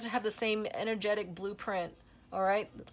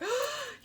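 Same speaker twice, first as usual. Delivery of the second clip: sharp excited gasp. Real, not acted. Card flew out of deck.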